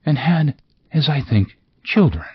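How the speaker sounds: high, airy ghost voice. Acted. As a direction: float it up high and light. Ghost gently probes Scrooge.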